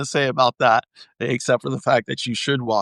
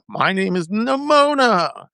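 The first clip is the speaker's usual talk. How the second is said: higher, slow playful Nimona line; imitating Nimona; 8 semitones higher than usual. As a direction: say it like the cartoon hero introducing herself. Quoting the movie character.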